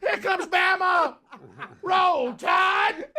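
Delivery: high, drawn-out fan yell; rowdy hollering. Retold or imitated. Latecomer drowns out a praying elder, high stretched shout. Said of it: "come in and just drown him out".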